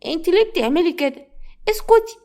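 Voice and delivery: high-pitched tearful protest; frightened crying boy. Acted. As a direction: beg in panic like a scared little boy. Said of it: "عمر كان بيعيط بخوف".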